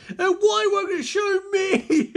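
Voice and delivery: loud, high anguished wail; crying villain. Acted. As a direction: wail in frustrated envy. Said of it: "as Scarecrow starts crying".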